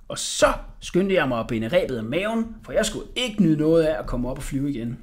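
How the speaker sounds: ordinary delivery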